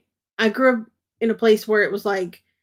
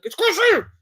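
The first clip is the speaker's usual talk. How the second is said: higher mock correction of "crochet"; imitating aunt; 11 semitones higher, 4 dB louder than usual. Aunt correcting her niece's word, higher mock voice. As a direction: play the aunt setting her niece straight, pitched up. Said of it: "my aunt was like".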